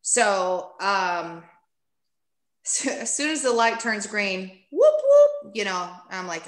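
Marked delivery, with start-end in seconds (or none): 4.7-5.6 high, loud siren whoop; imitating police siren. Imitating the cop's siren when the light turns green, high and loud. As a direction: mimic a squad car siren, way up high.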